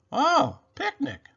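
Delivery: high, exclaiming rabbit voice. A narrator's reading. Rabbit replies to Robot at the picnic, high exclamation. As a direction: pitch it way up, then drop at the end. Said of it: "said Rabbit".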